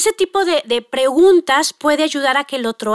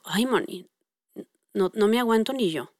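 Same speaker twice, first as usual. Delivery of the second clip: low, soft self-reproach; muttering to oneself; 5 semitones lower, 7 dB quieter than usual. Retold or imitated. Psychologist scolds herself after anger, low murmur. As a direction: sigh it privately under the breath. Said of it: "Así me digo a mí misma".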